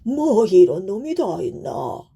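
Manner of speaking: slow, baffled beast voice; puzzled tiger. A narrator's reading. Tiger wonders at the fearless son, puzzled. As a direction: drag it out in disbelief as the tiger. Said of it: "하고 갸우뚱 쳐다보는 호랑이에게".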